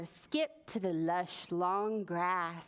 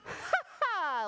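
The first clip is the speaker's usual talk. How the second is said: high, hearty storybook ha-ha; high, scoffing spider laugh; voiced laugh; 9 semitones higher than usual. A narrator's reading. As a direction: laugh boldly as the fearless spider. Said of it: "laughed Spider".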